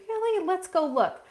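high, sceptical "Really?" then lighter; surprised doubt. Retold or imitated. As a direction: playfully question the client's worry. Coach checking client's claim of slowdown.

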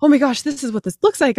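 high-pitched, excited gasp of realization; acted-out thrill of a new idea; joy of discovery. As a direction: gush as if it all just clicked. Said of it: "the joy in the discovery of".

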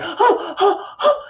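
sharp high gasp, fighting for air; panicked gasp for air; hyperventilating gasp. Retold or imitated. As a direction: gasp in like you can't get breath. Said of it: "I would hyperventilate".

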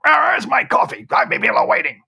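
high, loud retold boss yell; hammed-up impatient shout; angry shouting. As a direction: imitate a bully yelling at the barista. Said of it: "the guy was shouting all the time".

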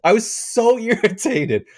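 irritated. Retold or imitated. Still worked up from rant.

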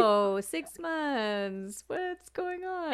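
slow, drawn-out teasing question; mock waiting parent. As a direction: imitate a parent prodding through the bedroom door. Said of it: "your parents are waiting at".

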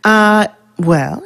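ordinary talk, nothing marked

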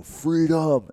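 arm up, shouting freedom to the crowd; yelled battle cry. Retold or imitated. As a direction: roar it out to a hall. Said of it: "throw his arm up in the air and yell".

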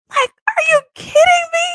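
a high, loud cry of happy disbelief; overjoyed disbelief. Real, not acted. To her partner about the carriage ride, overjoyed disbelief. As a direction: squeal the question as if it's happening now.

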